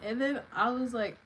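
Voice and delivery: usual speaking voice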